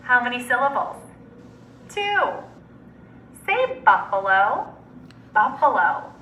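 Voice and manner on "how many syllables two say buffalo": ordinary talk, nothing marked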